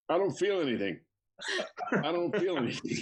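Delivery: imitating talk on a held breath; holding smoke in. Father getting stoned with son's buddies, holding smoke in. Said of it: "He's holding it in".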